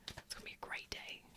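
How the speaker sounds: breathy whispered echo. Shown as a demonstration. Host echoes her promise to the chat in a whisper. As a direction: whisper it back like a secret.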